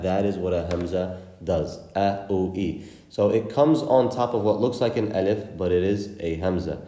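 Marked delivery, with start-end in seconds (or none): none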